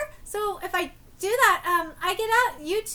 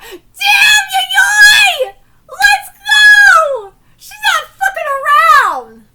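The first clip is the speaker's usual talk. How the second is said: much higher, very loud frantic shouts; alarmed yelling; 12 semitones higher, 18 dB louder, much slower than usual. Real, not acted. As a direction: yell the alarm at full volume.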